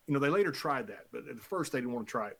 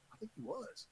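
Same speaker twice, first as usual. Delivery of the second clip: tiny, barely voiced imitation; very soft retold whisper; stunned whisper; 6 semitones higher, 15 dB quieter than usual. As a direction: whisper it as a dawning realisation. Acted stunned quote of colleague.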